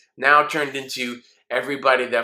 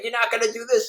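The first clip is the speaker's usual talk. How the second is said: mock king; 11 semitones higher, faster than usual. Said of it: "He's like".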